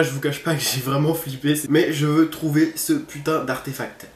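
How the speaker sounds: usual speaking voice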